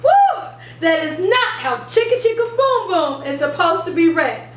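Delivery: ordinary talk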